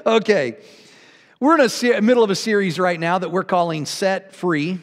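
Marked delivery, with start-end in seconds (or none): none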